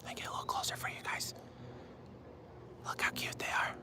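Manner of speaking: soft unvoiced whisper; leaning in to whisper. Real, not acted. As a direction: whisper so the ducks can't hear. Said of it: "What's the problem with me whispering?".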